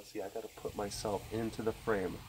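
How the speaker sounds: usual speaking voice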